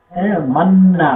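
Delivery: ordinary talk, nothing marked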